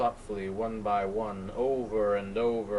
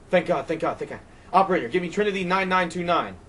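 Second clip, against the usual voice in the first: higher, louder, fast gush of relief; frantic relief; 8 semitones higher, 6 dB louder, faster than usual. Acted. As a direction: rush out the words in relief.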